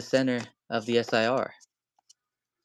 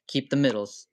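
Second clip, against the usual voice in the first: normal talking voice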